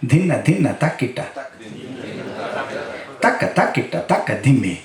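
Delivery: very fast ଧିନ ଧିନ ତାକିଟା rattled off; fast rhythm recitation; rapid konnakol. Shown as a demonstration. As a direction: race through the syllables. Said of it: "fast konnakol recitation".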